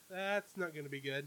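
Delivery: hushed aside, very soft; imagined viewer muttering under the breath; muted dread. Retold or imitated. Viewer watching trouble brew on screen, muttered.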